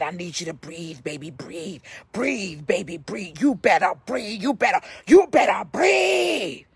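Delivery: re-enacted pleading that swells into insistence; retold urgent coaxing. Urging a struggling patient to breathe.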